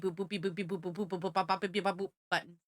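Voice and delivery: soft, rapid string of beeps and boops; arcade button boops. Retold or imitated. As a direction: mimic pressing arcade buttons, very quietly. Imitates pressing arcade buttons.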